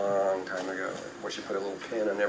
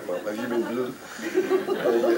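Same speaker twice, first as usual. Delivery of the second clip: laughing retelling; slower than usual. Retold or imitated. Repeated place quiz, laughing.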